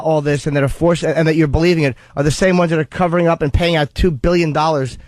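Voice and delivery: ordinary talk, nothing marked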